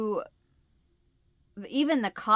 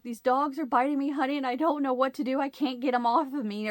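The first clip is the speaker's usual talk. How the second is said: higher-pitched, distressed call for help; retold tearful plea; 6 semitones higher than usual. Wife phoning husband as dogs bite her, tearful plea. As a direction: plead urgently, on the edge of crying. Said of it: "I'm starting to cry, and I'm like".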